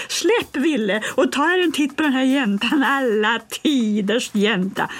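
put-on high, jeering boy's voice; mocking bully. Bully Bengt mocking the strange girl, high jeering voice. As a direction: sneer at the girl. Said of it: "sa han".

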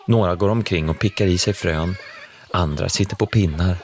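plain reading voice

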